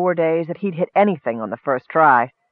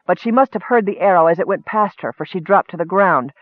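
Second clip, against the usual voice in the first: usual speaking voice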